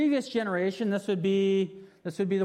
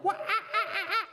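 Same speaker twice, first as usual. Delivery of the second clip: high laughing monkey hoots; 14 semitones higher than usual. Retold or imitated. Showing the kids what monkeys sound like, high hooting. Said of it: "They're like that".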